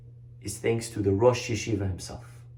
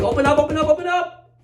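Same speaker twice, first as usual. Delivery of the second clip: much higher, louder yelling at a locked door; banging demand; 4 dB louder, faster than usual. Retold or imitated. Boys banging on the library door, shouting to open.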